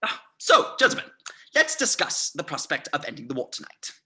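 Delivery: loud, expansive host's address; hearty opening. Acted. Colonel pouring Chianti for his captors, hearty opening. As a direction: open the talks with a big voice. Colonel opens the negotiation with drinks.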